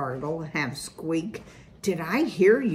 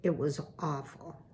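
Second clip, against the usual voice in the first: much softer, lower, barely voiced; hushed; 3 semitones lower, 9 dB quieter than usual. Poppy telling her parents of the loss, much softer. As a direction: let the grief drain the voice. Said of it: "she whispered".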